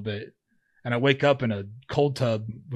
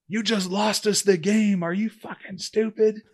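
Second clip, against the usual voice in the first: high, slow, furious bullhorn yell at a player; coach screaming; 9 semitones higher, slower than usual. Retold or imitated. Coach screaming at his player through a bullhorn, high and slow. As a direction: bellow it down at him like a furious coach. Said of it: "screaming at me with his bullhorn".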